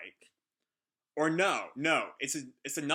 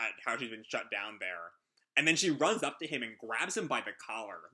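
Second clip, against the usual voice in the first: ordinary talk, nothing marked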